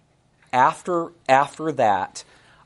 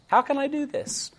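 high, soft pleading question; pleading high quote; 13 semitones higher, 3 dB quieter than usual. Acted. Preacher voicing Joseph refusing temptation, high and pleading. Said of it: "quoting Joseph's refusal".

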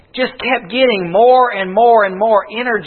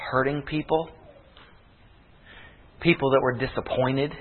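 hushed altar-call preaching; 8 semitones lower, 6 dB quieter than usual. Acted. Preacher to tired congregation before the altar call, hushed and soft. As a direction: speak quietly as if to a hurting crowd.

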